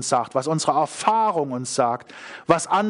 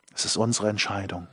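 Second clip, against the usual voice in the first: quiet insistence; 10 semitones lower, 6 dB quieter, faster than usual. Real, not acted.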